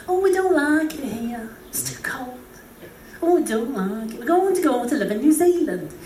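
put-on whingeing British old relative; pessimistic British uncle. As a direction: moan like a gloomy British uncle. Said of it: "had the gift of pessimism".

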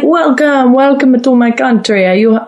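retold host with a rough Russian accent; mock Russian accent. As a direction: greet cheerily in a makeshift Russian accent. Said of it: "I don't know what accent that is. Sounded Russian".

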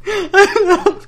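high squeal of laughter; laughing. Real, not acted. Agreeing with the host while laughing hard.

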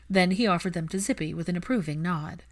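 plain reading voice

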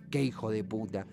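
low, soft, heavy curse; bitter venting. Shown as a demonstration. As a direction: mutter it as a real release of anger. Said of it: "o en forma de expiación".

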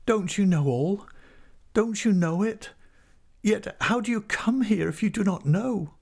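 very high, distressed woman's voice; agitated heroine, pitched far up; despairing woman. A narrator's reading. Tess despairing in story.

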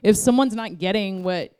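normal talking voice

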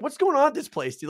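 high, incredulous outburst; bewildered. Retold or imitated. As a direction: squeal it in disbelief. Retells his bewilderment at the party.

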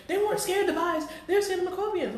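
high, mocking retort; mock their worried claim; mocking quote.

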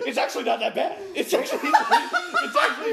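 high, loud protest breaking into laughter; mock defensive. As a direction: squeal it defensively through laughs. Mock-defending Hoboken to pitying friends.

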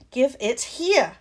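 loud, slow yell. Acted. Harry yells at Malfoy as he takes off, loud and slow. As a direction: bellow it after him. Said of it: "Harry yelled".

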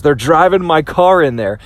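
usual speaking voice

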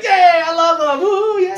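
high, slow whooping praise; mock proud dad cheering; fan Joseph. Joseph cheering once his son is famous, high whoop. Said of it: "he's like".

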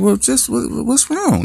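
very high, urgent "what's wrong"; alarmed. Retold or imitated. Dad on the highway fearing the worst, very high and urgent. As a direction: climb up in panic.

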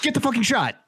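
high-pitched, insistent plea; exasperated urging. Real, not acted. Urging listeners to get vaccinated.

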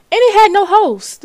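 loud scandalized exclamation; shocked exclamation. Real, not acted. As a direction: blurt it out in disbelief. Outraged exclamation about the show.

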